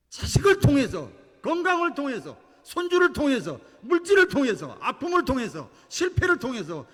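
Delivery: high, loud, insistent sermon cry; impassioned preacher building a shouted litany; full-force preaching. Real, not acted. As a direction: hammer out each item of the list at full voice. Preacher at full force, piling up phrases.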